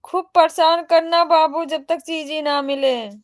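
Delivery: loud, high teasing call to the little one; playful loud urging. Real, not acted.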